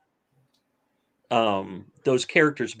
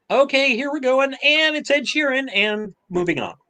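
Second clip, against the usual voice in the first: high, sing-song put-on voice; hammed-up high-pitched cameo reveal, easing down at the end; mock showy announcement; 13 semitones higher than usual.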